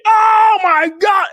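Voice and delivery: loud, high, drawn-out mock shock; huge long astonished yell; shouted amazement. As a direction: holler it in delighted disbelief. Retelling his delighted shocked reaction.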